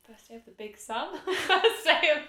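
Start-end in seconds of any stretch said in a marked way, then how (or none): 1.3-2.3 high, slow, laughing through words. Real, not acted. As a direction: giggle it out loud. Repeats phrase while laughing.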